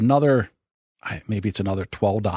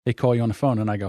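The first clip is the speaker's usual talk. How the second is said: normal talking voice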